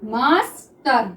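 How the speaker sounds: each sound drawn out slowly; teacher dictating a word slowly; slow dictation. Shown as a demonstration. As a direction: say it slowly as you write it. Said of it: "देखिए हम इसको किस तरह से बनाएंगे".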